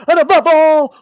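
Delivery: high, loud push on the phrase; emphatic build. Acted. Host building up his show's tagline, loud and high. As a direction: punch it like a hype line.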